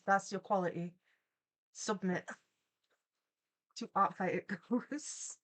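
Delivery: ordinary delivery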